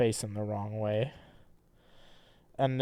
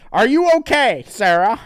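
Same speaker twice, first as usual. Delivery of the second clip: character voice; 11 semitones higher, 12 dB louder than usual. Acted. Rereading the same line.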